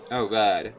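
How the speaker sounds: ordinary talk, nothing marked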